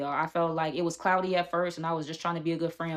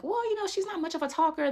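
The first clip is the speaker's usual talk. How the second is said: much higher, mock-polite voice; 12 semitones higher than usual. Friend fending off the security guard, mock HR voice. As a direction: put on a high, placating professional tone. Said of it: "starts talking in her H R voice".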